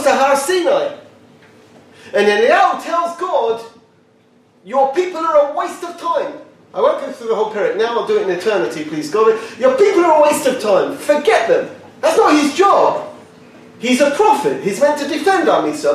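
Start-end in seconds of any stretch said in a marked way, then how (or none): 4.6-6.3 Eliyahu complaining. Retold or imitated. 9.6-11.7 Eliyahu complaining. Retold or imitated. Eliyahu complaining to God again.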